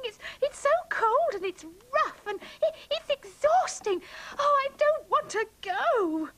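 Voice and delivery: mock pleading. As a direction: overact begging Matthew not to take them. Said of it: "Listen to this".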